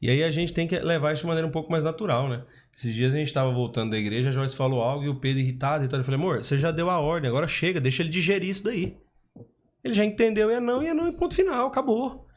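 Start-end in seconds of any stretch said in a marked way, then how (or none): 6.2-12.3 firm telling-off. Retold or imitated. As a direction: start firm, then climb steeply in pitch. Firmly telling wife to stop.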